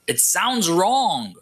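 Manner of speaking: slow, drawn-out "sounds WRONG", voice rising then falling; emphatic. Real, not acted. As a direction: stretch out both SOUNDS and WRONG. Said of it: "I wanna emphasize that".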